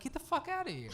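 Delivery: high-pitched, mildly incredulous reaction; retold disbelief. Retold disbelief at cheap ticket.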